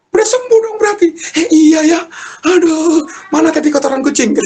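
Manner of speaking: very high, loud comic squealing; excited realisation. Retold or imitated. Couple realise her smell has returned, excited high squeals. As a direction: squeal with sudden delighted discovery.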